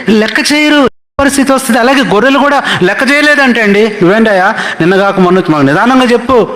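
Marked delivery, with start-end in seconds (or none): 3.9-6.5 higher, quicker mock sheep backtalk; cheeky sheep. Sheep scolding Moses for hitting them, high cheeky voice. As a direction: talk back to the shepherd like an insolent sheep. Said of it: "అన్నయ్య అంట గొర్రెలు మోషేతో".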